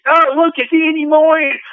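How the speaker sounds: imitating cruise fans who tease him every time they see him, mock gushing. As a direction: ham up the fake adoration to razz him.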